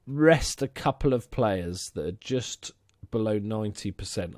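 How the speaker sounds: usual speaking voice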